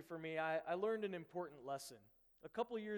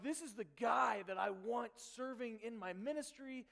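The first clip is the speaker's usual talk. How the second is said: retold dismay, climbing higher and lighter; baffled disbelief; 5 semitones higher, 4 dB louder than usual. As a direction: sound stunned that your best leader would quit. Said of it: "And I'm like".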